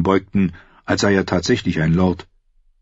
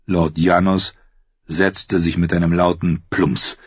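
ordinary delivery

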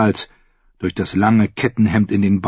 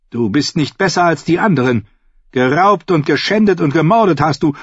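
raised, higher-pitched condemnation; stern accusation; 8 semitones higher than usual. A narrator's reading. Brienne confronts the surrendering fool, stern and accusing. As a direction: accuse him with righteous force.

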